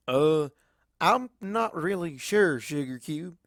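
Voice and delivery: slow, unsure country voice; hesitant Applejack. A narrator's reading. Applejack answering Pinkie, slow and unsure. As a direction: drag it out, puzzled.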